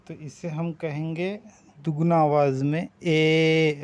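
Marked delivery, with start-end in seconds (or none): 1.8-3.8 slow, with the ए drawn out double; stretched e. Shown as a demonstration. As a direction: hold the ए long. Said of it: "ऐसा खींचेंगे". (ए को दुगना खींचकर)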